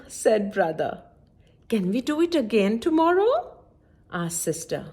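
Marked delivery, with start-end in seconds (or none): 1.7-3.3 high, fast tumbling plea; eager rush. A narrator's reading. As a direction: rattle it off eagerly. Sister begs eagerly.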